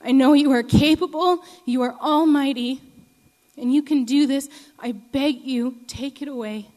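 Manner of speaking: a broken man pleading loudly to be healed; desperate begging prayer. Retold or imitated. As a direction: cry out to God on your knees, begging. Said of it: "he's sobbing, and he's begging".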